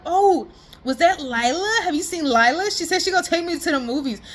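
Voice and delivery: little brother pestering Sean, lightly voiced; mild kid voice.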